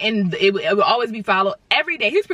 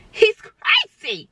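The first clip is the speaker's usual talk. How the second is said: shrieked; 13 semitones higher, much slower than usual. Real, not acted. Warning the viewers about the stalker, with a high drawn-out shriek. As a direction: scream it out, dragging each word.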